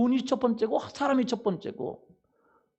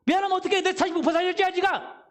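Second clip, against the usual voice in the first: acted outraged shout; 9 semitones higher, 4 dB louder, faster than usual. Rear driver pressing for money.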